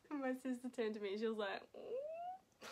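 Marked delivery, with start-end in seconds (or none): 1.7-2.6 high, thrilled "Ooh"; an impressed squeal; a sister's excited coo. Retold or imitated. Sister reacting to yelling.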